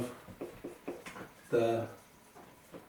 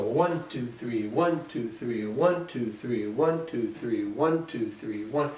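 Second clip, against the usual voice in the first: running count of three, "one" stronger than "two, three"; pulse on one; slower than usual. Shown as a demonstration. Teacher counts in three for students, accenting "one". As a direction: lean on every "one" in a steady waltz count. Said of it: "So one has the pulse".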